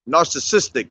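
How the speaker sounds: Narcissistic sounded out clearly as nar-suh-SIS-tik; careful pronunciation. Shown as a demonstration. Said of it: "That's how you say it".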